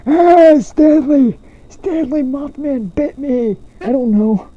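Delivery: put-on high, strained old man cry; panicked old man calling Stanley. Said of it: "giving him an old man voice".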